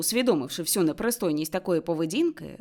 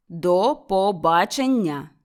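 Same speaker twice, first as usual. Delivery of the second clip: slow, louder, final goodbye; firm dismissal; 6 dB louder, much slower than usual. A narrator's reading. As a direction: end the talk with finality. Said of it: "повторив Скрудж". (рішуче прощання)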